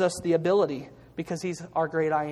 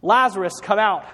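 louder ringing call, pitched up; 3 semitones higher, 6 dB louder than usual. A narrator's reading. Jesus at the tomb calling dead Lazarus out, loud call. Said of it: "said in a loud voice".

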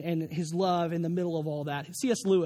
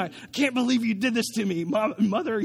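imitating an indignant little girl, lightly raised; mock angry child; 2 semitones higher, 3 dB louder than usual. Daughter scolding her mother after the shot, mock angry. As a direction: accuse Mom in playful outrage. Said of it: "She's mad".

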